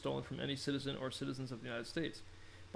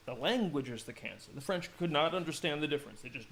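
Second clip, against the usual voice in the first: ordinary delivery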